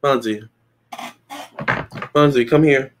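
ordinary talk

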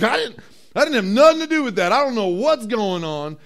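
hammed-up frantic self-defence; mock panicked denial. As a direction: protest your innocence in a comic panic. Acts panicked Joseph denying blame.

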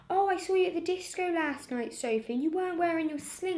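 high, fast gotcha tone; mock teacher voice. Child mimicking the substitute teacher, high mock gotcha. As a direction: catch the kid out, sing-song. Teacher's sneaky accusation quoted.

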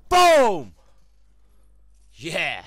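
high, loud triumphant yell; celebrating a kill; victory shout. Real, not acted. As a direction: blast it out.

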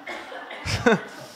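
breathy high laugh; a quick airy burst of laughter. Real, not acted. Preacher laughs at own joke to the church.

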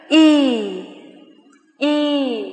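drawn-out long "y" vowel, held ringing; long ee sound. Shown as a demonstration. Teacher modelling a long ee vowel, drawn out. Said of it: "Các bạn cần phải đọc âm ngân vang".

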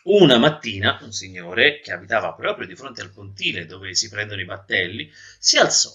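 ordinary delivery, nothing marked

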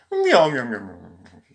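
playful mock cat meows; imitated meows. Mimics cat while calling it.

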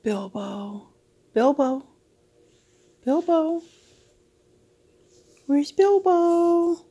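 rising sing-song call; calling the pet. Real, not acted.

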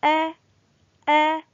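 "أ" said as a short open a, on its own; alif with fatha. Shown as a demonstration. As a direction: sound out "أ" as a level, isolated vowel. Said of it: "فيصبح صوته". (نطق "أ" بالفتحة منفردة وواضحة)

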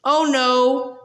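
plain reading voice

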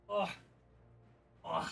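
ordinary talk

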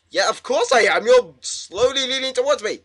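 high, loud and panicky; rattled, high-pitched exclamation; alarmed outburst. Real, not acted. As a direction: protest loudly in rising alarm. Scared as figure leans closer.

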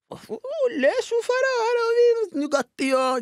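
very high, loud gleeful squeal; mock kid excitement. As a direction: squeal like a delighted child. Excited kids seeing the food. (begeistret barnehyl, veldig høyt)

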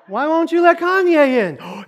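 high, loud put-on whine; mock indignant question. Outsider asking why [name] is barred, mock high wail.

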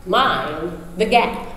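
low, very slow British station announcer; measured British PA announcement, spaced word by word; British accent. Retold or imitated. Woman announcing to train passengers over the PA, British accent. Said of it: "this woman with a British accent".

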